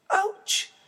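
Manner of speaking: high sharp yelp; ouch. Acted. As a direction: yelp at the heat. Goldilocks burned.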